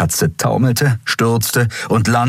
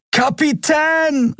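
long, very high, slow call; much slower than usual. A narrator's reading. Miko calls the captain while jumping, drawn-out high call. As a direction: cry out as he leaps. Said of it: "rief Miko und sprang".

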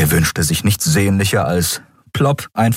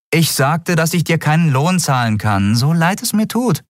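higher female captain voice; captain's voice; 10 semitones higher than usual. Acted. Captain Nagun to Miko about no pay, higher voice. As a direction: say it plainly, a bit sorry.

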